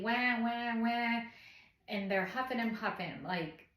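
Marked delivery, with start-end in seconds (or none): 0.0-1.2 imitated baby wail. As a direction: imitate a newborn waking up crying. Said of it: "they wake up immediately".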